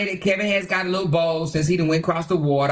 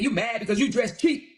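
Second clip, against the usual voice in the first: higher, gloating mock voice; mock Kevin bragging to his critics, higher and gloating; mocking boastful Kevin; 8 semitones higher than usual. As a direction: lord it over everyone like a show-off. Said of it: "He said".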